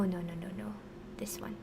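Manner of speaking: soft whispered self-correction; whispering. Real, not acted. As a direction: whisper it under your breath. Muttering to self while writing.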